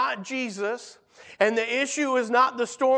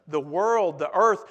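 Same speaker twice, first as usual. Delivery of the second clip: normal talking voice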